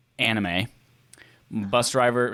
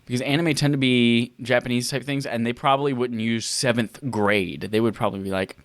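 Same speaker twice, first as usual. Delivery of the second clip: ordinary talk, nothing marked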